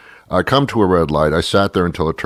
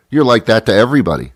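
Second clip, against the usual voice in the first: normal talking voice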